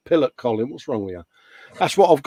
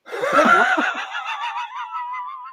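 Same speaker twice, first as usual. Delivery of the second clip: high shrieking laugh that bursts out; big burst of laughter. Real, not acted. Host cracking up after reading viewer feedback, shrieking laugh.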